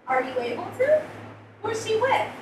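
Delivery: put-on a surprised aid worker checking on the child; translator's voice. A narrator's reading. Translator asks who minds the sister, lightly voiced concern. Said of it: "She blinks".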